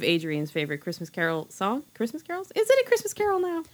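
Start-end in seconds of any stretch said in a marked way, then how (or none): 1.9-3.6 high, fast disbelieving question; incredulous. Real, not acted. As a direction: squeak it in disbelief. Questioning song's status.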